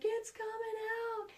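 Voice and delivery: put-on high, wailing little-kid voice; crying child. Son wailing to mom on the trail, high tearful kid voice. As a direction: imitate her son in a panic. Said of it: "he's like".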